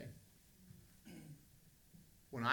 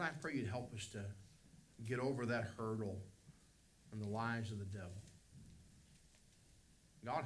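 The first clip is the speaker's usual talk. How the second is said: closing prayer. Acted. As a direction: pray it humbly for the congregation.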